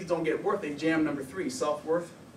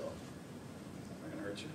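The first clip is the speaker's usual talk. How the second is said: reassuring whisper; 9 semitones lower, 19 dB quieter, slower than usual. Retold or imitated. Counselor reassuring a gang client.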